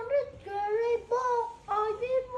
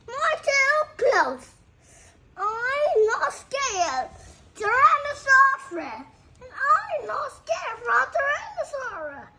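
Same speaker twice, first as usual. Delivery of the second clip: louder, higher kid playing a brave dinosaur; boastful dinosaur; 6 semitones higher, 7 dB louder than usual. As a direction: puff up like a fearless dinosaur. Voices a boasting toy dinosaur.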